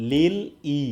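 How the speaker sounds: লিল ই, the l and i split apart; syllable split demo. Shown as a demonstration. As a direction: say লিল then ই as a separate beat. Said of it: "এরকম pronunciation".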